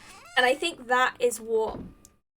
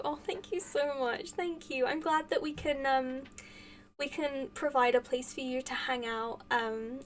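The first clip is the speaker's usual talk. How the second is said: ordinary delivery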